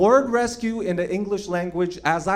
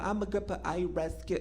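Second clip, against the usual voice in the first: smug mimic; 9 dB quieter than usual. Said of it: "people virtue signal".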